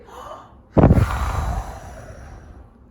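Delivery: long loud huffing blow; loud wolf blowing at the brick house; huge blow. Pretend. Reader blows at brick house.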